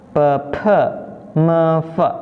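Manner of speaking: evenly spaced "P, P, me, fe" with schwa endings; pinyin sound drill. Shown as a demonstration. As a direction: model "P, P, me, fe" as isolated syllables. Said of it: "tulisan cara bacanya".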